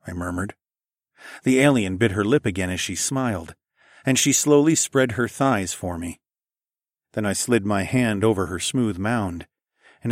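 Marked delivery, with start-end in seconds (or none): none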